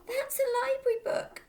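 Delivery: put-on high, loud protest from a girl; cried out. A narrator's reading. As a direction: cry out in alarm to stop him. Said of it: "Matilda cried".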